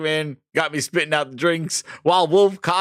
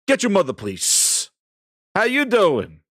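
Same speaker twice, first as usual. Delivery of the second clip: ordinary talk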